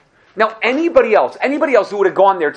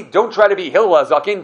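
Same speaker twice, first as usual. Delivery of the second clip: ordinary talk, nothing marked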